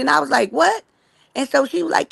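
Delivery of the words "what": sharp high disbelieving what; stunned at the news; surprised what. Retold or imitated. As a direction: caught off guard, shoot the word up high. Hearing the girl made parole.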